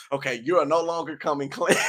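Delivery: breaking into laughter mid-line; laughing. Real, not acted. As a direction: try to say it through a laugh.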